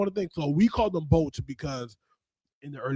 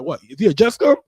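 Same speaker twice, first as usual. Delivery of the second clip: louder, higher, surprised "What? Is he a just come?"; surprised outburst; 6 semitones higher, 12 dB louder, faster than usual. Retold or imitated. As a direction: ask it in loud mock disbelief. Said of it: "We'll be like".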